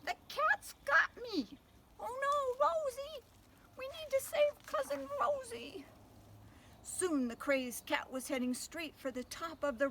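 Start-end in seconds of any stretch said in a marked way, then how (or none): 0.1-5.9 high, louder frantic squeal; panicked squeal. Acted. Panicked mice voiced in story.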